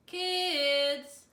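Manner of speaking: loud call from the back door. A narrator's reading. Miss Dickerson calls the kids from her door, loud.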